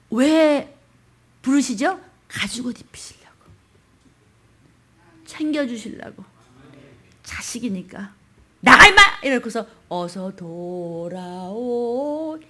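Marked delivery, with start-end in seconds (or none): 2.3-6.2 whispered softly. Real, not acted. 7.2-8.1 hushed whisper. Real, not acted. 8.6-9.0 loud, high shout to get out; angry shout. Acted. Father driving child out. 9.9-12.4 very slow, soft longing call; tender pleading. Acted. Father longing for child's return.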